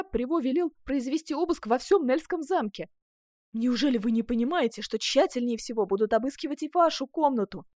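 loud, high, urgent explanation; agitated warning. A narrator's reading. Duchess warning Ascanio of a search, agitated. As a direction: press the danger on him urgently.

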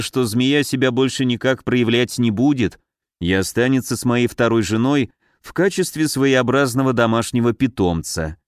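usual speaking voice

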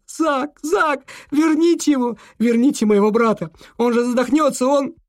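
high, loud panicked shouting; desperate scream. Acted. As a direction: scream for her brother. Girl screams for drowning brother.

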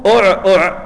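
"أع أع" with hamza carrying u, then a silent ain; pronouncing the word. Shown as a demonstration. Teacher shows students how the gagging word is vocalised, careful pronunciation. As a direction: say "أع أع" as u-glottal then a cut-off throaty ain, twice. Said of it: "بضم الهمزة وسكون العين".

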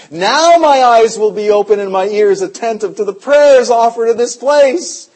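loud, driving sermon voice; fiery preaching. Acted. Preaching scripture at full force.

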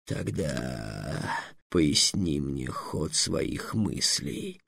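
a dragon's slow low threat; threatening dragon. A narrator's reading. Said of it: "угрожающе потребовал дракон". (низкий медленный угрожающий голос дракона)

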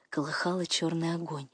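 usual speaking voice

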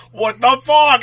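loud, high mock outrage; exasperated outburst. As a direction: re-enact losing it at a honking driver. Retold outburst at honking driver.

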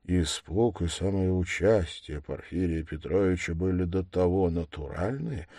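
usual speaking voice

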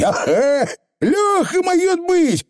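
squeaky little Scottish pixie; high, rough tiny rascal; Feegle voice. Acted.

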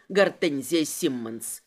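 low, slow mocking name; slow low interrogator's sneer; smug amusement. A narrator's reading. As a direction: savour the name with a smirk. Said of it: "усмехнулся он".

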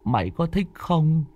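put-on high, frightened voice of a shaken young man. A narrator's reading. Mạnh tells Tuất about the ghost girl, frightened high voice. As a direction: tell the ghost encounter trembling with fear.

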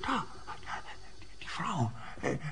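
hushed urgent whisper. Acted. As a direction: whisper so she can't hear. Spotting suspect, hushed.

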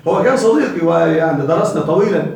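usual speaking voice

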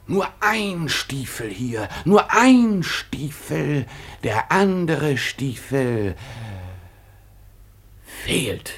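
slow, drawn-out realization; a detective savoring the find, slow; slow dramatic discovery. Acted. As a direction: spell out each clue slowly.

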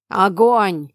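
loud shouted order; shouted command. Acted. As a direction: yell the order to fire. Snake-man gives the order. (громкий крик-команда)

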